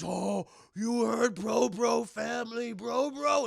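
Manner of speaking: giant robot voice. Retold or imitated.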